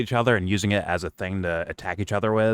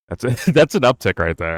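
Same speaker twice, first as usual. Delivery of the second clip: laughing; 4 semitones higher, 7 dB louder than usual. Real, not acted. Laughs mid-line.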